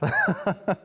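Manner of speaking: a quick, bright burst of laughter; short real laugh. Real, not acted. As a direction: laugh at your own story. Ends story, laughs.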